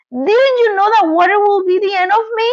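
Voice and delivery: high, fast wailing voice; wailing despair. A narrator's reading. Melting witch to Dorothy, high fast wailing reproach. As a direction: wail it in despair. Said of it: "in a wailing, despairing voice".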